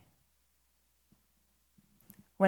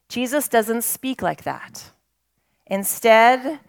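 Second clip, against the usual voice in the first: usual speaking voice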